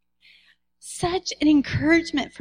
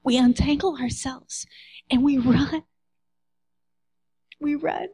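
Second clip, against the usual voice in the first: hushed preaching. Real, not acted.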